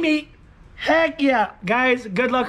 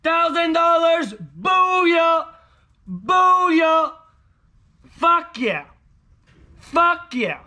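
excited shouting; 7 semitones higher, much slower than usual. Real, not acted. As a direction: yell it out in triumph. Still celebrating the win.

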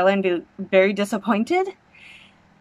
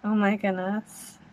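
softer, awestruck murmur; awed hush; 7 dB quieter than usual. Real, not acted. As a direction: say it under your breath, in awe. Reacting live to trailer.

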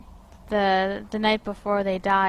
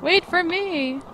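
calling out; 8 semitones higher, slower than usual. Pretend. Calling after running figure.

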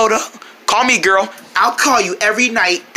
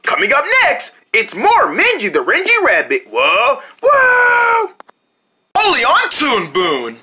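TV announcer; 6 semitones higher, slower than usual. Acted. TV announcer bumper.

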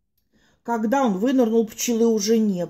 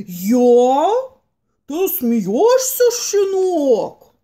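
big dog voice; 3 semitones higher, 5 dB louder, much slower than usual. Acted. Big dog answers menacingly.

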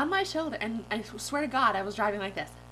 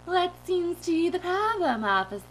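high, loud, put-on innocence; silly dream-self voice; 9 semitones higher, 5 dB louder than usual. Driver greeting the officer at the window, silly innocent voice. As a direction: play innocent in a goofy high voice. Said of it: "I'm talking like this".

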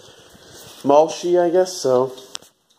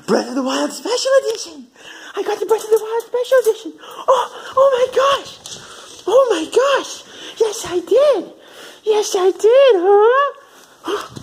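much higher, squealing happy voice with a laugh; excited pet talk; slower than usual. Real, not acted. Owner gushing to her dog about the game, high and excited. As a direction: gush at the dog like it's the best news ever.

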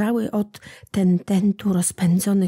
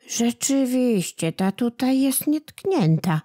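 sighing; 4 semitones higher than usual. A narrator's reading. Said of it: "westchnęli tamci".

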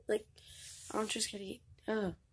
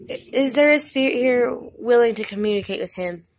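calling out to a spirit; 3 semitones higher, 6 dB louder than usual. Acted.

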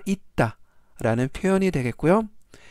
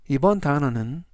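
ordinary talk

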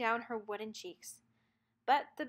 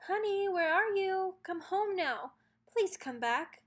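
put-on calling for child; 7 semitones higher, 3 dB louder than usual. A narrator's reading. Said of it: "They called and called".

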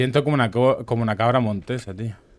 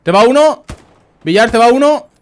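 high, loud urgent callout; shouted warning; 9 semitones higher, 8 dB louder than usual. Real, not acted. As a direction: yell to warn a teammate. Warning teammate of enemy. (grito de aviso urgente)

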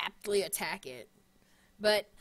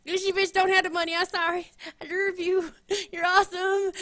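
playful loud pleading; 8 semitones higher, 8 dB louder than usual. Real, not acted. Joking plea to [name].

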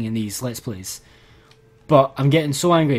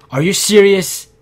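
louder and higher, exasperated; angry outburst; 5 semitones higher, 7 dB louder than usual. Real, not acted. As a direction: snap it out at the game, fed up. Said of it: "I'm angry".

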